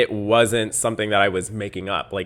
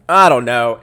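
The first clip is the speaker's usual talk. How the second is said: much louder, frustrated exclamation; loud exasperation; 3 semitones higher, 13 dB louder than usual. Real, not acted. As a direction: burst out with it. Repeats it loudly in exasperation.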